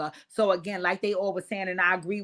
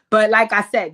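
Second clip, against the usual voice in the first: ordinary delivery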